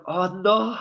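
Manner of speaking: a player who scored but has to hug the boss; mock dread. [name] after his goal, facing the manager, mock dread.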